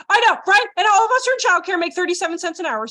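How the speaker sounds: loud, high burst of excitement; high, loud and eager; excited. Real, not acted. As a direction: crow about the prize. Excited reaction to prize money.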